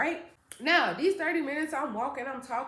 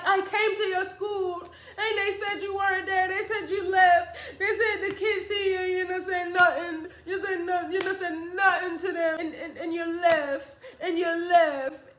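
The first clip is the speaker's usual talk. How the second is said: high, upset protest; tearful mother; 6 semitones higher, 4 dB louder than usual. Retold or imitated. Mother who came to school and found child gone, crying. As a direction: recount it in a raised, tearful voice. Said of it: "she just crying even more".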